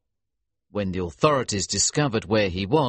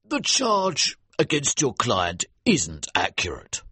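agreeing judge. A narrator's reading. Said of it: "the judge agreed".

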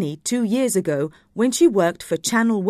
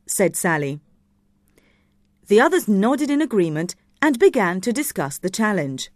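ordinary delivery, nothing marked

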